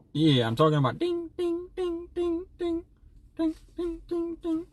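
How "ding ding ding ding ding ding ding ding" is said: ping it out high and evenly; high dinging noise. Acted. Streamer showing what miners sound like, high dings. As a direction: run of soft, very high dings. Imitates miners' pickaxes ringing.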